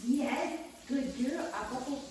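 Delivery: high, bright praise for the dog. Real, not acted. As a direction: cheer the dog on warmly.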